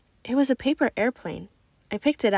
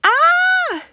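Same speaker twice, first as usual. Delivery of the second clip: long, very high, very loud scream; piercing scream; 10 dB louder than usual. Acted. Overwhelmed boy screams long and high in noisy cafeteria. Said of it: "Lucas was screaming".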